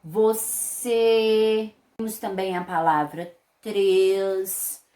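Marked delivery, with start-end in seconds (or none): 0.0-1.7 "você" drawn out very slowly, closed final ê; slow classroom demo of "você"; closed vowel demo. Shown as a demonstration. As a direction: hold the muffled closed "ê" in "você". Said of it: "Fica um sonzinho mais abafado".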